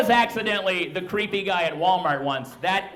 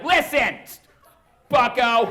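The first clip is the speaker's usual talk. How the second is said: high, raised-voice scolding; panicked dad; 5 semitones higher, 5 dB louder than usual. Retold or imitated. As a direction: blurt a flustered telling-off at a kid. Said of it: "I just panicked".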